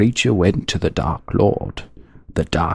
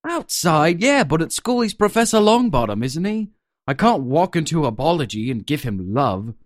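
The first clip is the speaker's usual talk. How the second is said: put-on kid protest, quicker; 8 semitones higher than usual. Second take.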